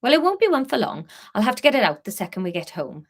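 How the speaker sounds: fast breezy Welsh lilt; Welsh Harry. Acted. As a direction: rattle it off quickly in Welsh. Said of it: "I can vaguely do Welsh".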